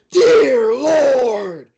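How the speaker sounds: high, very loud drawn-out shout; signature knockout call. Retold or imitated. Commentator re-creating his favorite knockout call, full-voiced high shout. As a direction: belt it out like a stunned fight announcer. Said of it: "one of my favorite calls".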